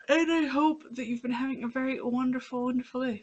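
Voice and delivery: talking through a yawn; stretched, yawning words. Real, not acted. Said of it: "Sorry for the yawn".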